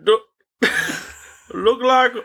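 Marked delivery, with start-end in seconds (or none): 1.5-2.1 higher, halting attempt at a Bangladeshi accent; badly faked Bangladeshi accent. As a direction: fumble through a mock Bangladeshi scammer accent. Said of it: "can't do that accent".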